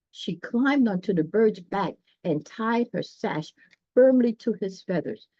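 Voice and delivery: plain reading voice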